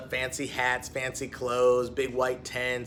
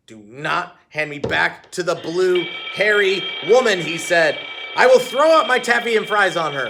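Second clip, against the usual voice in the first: loud, high panicked plea; panicked dog; 4 semitones higher, 8 dB louder than usual. A narrator's reading. Sam begging Heidi not to hand him back, loud and panicked.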